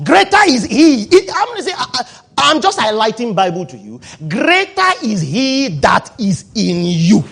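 slow, loud, drawn-out pulpit cry; full-force preaching. Acted. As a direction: preach it out to the whole hall, stretching each word.

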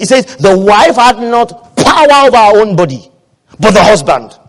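loud, high-pitched sermon proclamation; forceful preaching. Real, not acted. Preacher declaring scripture to the congregation, forceful preaching. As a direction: thunder the verse to the church.

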